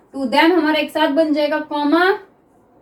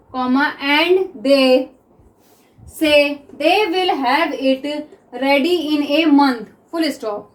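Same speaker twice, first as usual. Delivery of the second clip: very slow, word by word with pauses; slow dictation; much slower than usual. Shown as a demonstration. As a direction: dictate each word so students can write. Teacher dictates sentence slowly.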